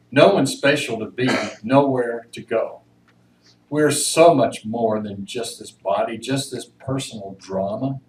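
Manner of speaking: measured, earnest reading with swelling emphasis; poem reading. Acted. Reading an inmate's poem to the audience, earnest poem recital. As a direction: read it like a meditation on freedom.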